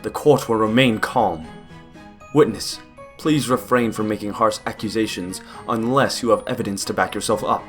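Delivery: stern judge. Acted. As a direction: boom it out over a noisy courtroom. Judge calls noisy courtroom to order.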